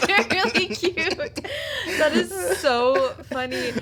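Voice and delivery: tickled, laughing at the cute scene. Real, not acted. Reacting to the story.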